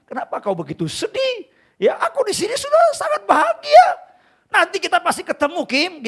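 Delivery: high, laughing, happy friend's voice; imagined friend in heaven. Pretend. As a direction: cheerfully comfort him from heaven. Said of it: "ini saya membayangkan".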